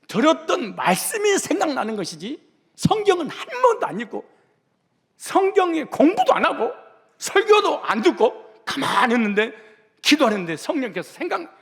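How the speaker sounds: high, loud, emphatic sermon delivery; full-force preaching. Real, not acted. Preacher scorning believers who never read the Bible, full-force preaching. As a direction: hammer each clause home, pitched high.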